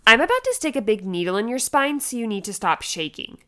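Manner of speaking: imitated bossy order, loud at the start; bossy anesthesiologist. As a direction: lay down the law like a bossy doctor. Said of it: "she just was, like, really bossy".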